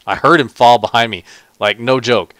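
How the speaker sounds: loud, rattled telling; startled loud. Real, not acted. Gamer telling viewers a creeper just fell behind him, loud and rattled. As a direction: blurt it out, still startled.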